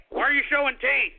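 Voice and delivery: usual speaking voice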